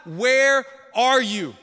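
loud, high pleading at God; anguished prayer. Retold or imitated. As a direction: hurl the questions upward.

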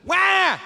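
high mock baby wail; baby cry imitation. Shown as a demonstration. Pastor imitating a baby's cry for the congregation. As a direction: wail like a fussy infant. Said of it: "what do babies sound like?".

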